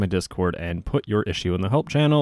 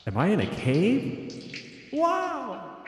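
high, echoing cave voice; cave effect; 13 semitones higher, 4 dB quieter, much slower than usual. Shown as a demonstration. Streamer testing the cave voice filter, echoing. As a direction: call out slowly into a cavern. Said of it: "But you have Cave".

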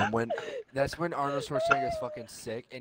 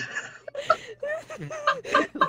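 high breathless wheeze of laughter; helpless wheezing cackle; losing it to a wheezy laugh; 5 dB louder than usual. Real, not acted. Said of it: "Legit wheeze".

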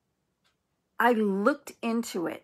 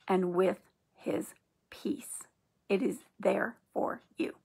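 whisper; 3 dB quieter than usual. Real, not acted. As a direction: whisper the blessing gently. Closing prayer for the listener.

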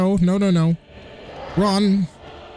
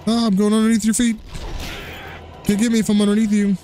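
high teasing taunt; 10 semitones higher than usual. Pretend. Teasing the boss.

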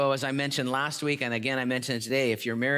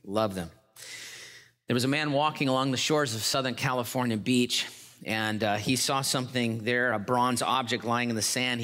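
usual speaking voice

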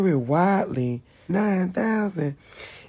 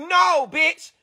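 angry interruption; 10 semitones higher than usual. Real, not acted. Cutting off assistant.

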